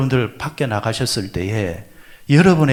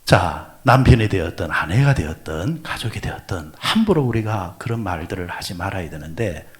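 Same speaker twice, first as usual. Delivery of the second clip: usual speaking voice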